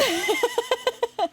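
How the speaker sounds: a quick high squeal of a laugh; high giggle. Real, not acted. Player reacts to stags fighting with a high giggle. Stag beetles fighting on screen.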